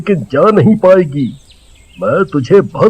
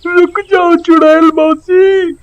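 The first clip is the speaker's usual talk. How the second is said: high, begging call; pleading child; 12 semitones higher than usual. Acted. Child begging the witch aunt to stay, high pleading. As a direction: plead with her not to leave.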